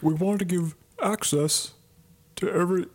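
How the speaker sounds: breaking into laughs mid-impression; Elizabeth Holmes voice. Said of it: "trying to sound like her".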